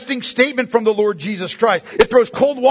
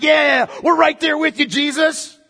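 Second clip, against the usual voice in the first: higher, shouted eager agreement; fervent fake follower; 5 semitones higher than usual. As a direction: shout your hollow support. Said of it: "It's the idea like".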